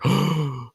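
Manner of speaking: imitated gasp of a stunned party; sharp, acted intake of breath; mock shocked gasp. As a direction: gasp as if the whole room froze. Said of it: "the whole party just stops".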